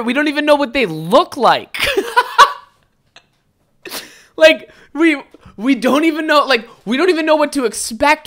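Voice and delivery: high-pitched words through laughter; real laughter spilling into the words; laughing. Real, not acted. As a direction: crack up mid-quote. Laugh heard on these lines.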